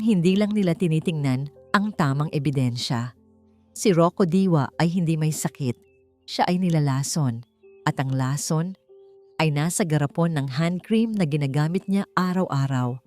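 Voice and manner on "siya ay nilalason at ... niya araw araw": plain reading voice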